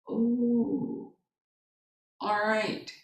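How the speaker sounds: very soft retold concession; chastened acceptance. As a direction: give in quietly, slowly. Mother gives in, chastened.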